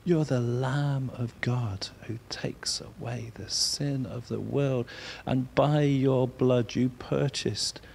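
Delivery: slow, soft, reverent prayer; just above a whisper, praying to Jesus; hushed worship. Shown as a demonstration. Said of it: "if you whisper it and say".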